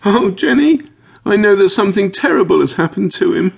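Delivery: very high, loud acted wail; high-pitched, distraught outburst; sobbing. A narrator's reading. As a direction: wail it as if in tears. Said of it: "puts her hand over her eyes and sobs".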